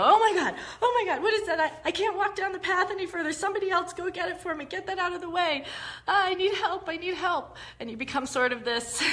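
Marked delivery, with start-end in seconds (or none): 0.0-7.6 high, loud, laughing panic over a snake; panicked child state. Retold or imitated. Hiker spotting a snake, panicked high squealing plea. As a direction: squeal for help like a scared child. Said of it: "you go".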